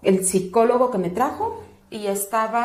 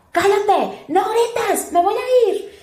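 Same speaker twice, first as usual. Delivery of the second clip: high, loud imitation of a scolding shout; retold yelling; 11 semitones higher, 4 dB louder, much slower than usual. Birth attendant threatens to leave, high scolding yell. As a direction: yell it sharply, imitating a woman losing patience. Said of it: "Entonces ella me gritaba".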